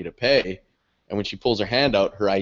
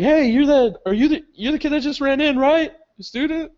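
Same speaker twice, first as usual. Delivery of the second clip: high-pitched mock challenge; suspicious campus officer; 5 dB louder than usual. Campus officer stopping the kid who ran in, mock confrontation. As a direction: call out and question a runaway student.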